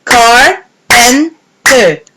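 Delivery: slow, beat by beat: Car-pen-ter; clapped syllables. Shown as a demonstration. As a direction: clap out each syllable of Carpenter. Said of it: "Let's clap together".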